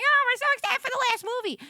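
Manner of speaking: high, fast gushing fan voice; excited fans. Retold or imitated. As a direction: gush like a costumed fan. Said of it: "being like".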